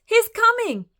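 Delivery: high, loud urgent warning; alarmed cry. A narrator's reading. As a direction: warn in a flustered cry. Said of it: "cried the giantess".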